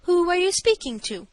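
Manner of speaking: lofty, affronted dignity; stately manner. A narrator's reading. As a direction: inquire in your stateliest manner. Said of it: "I inquired in my stateliest manner".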